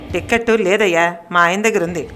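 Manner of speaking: ordinary delivery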